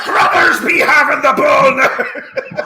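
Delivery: put-on high, loud, slow laughing pirate accent. Pirate commentator shouting the polo call. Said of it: "imagine that with a pirate accent".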